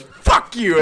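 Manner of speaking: loud, high mock outburst; aggressive. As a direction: hurl it like slamming down a book. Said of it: "it's aggressive the way she sla".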